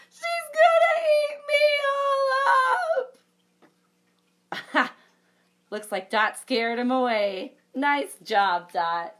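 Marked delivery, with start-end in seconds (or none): 0.1-4.9 very high, loud wail; panicked bear. Acted. The scared bear fleeing, a high wail. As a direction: cry out running away.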